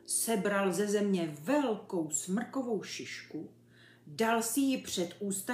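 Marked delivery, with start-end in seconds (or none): none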